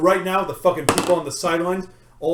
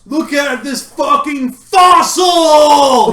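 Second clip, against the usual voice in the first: slow, loud jeering yell; 5 semitones higher, 7 dB louder, much slower than usual. Acted. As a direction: bellow it out at the crowd. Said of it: "He yells".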